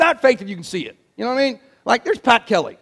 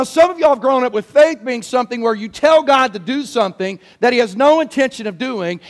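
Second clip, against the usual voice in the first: normal talking voice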